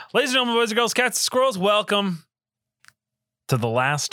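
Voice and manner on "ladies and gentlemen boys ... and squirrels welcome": grand announcer call, pitched high; ringmaster. Acted. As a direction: belt it out like a circus ringmaster. Restarted grand announcer intro.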